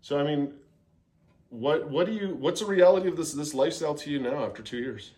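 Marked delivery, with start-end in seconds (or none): none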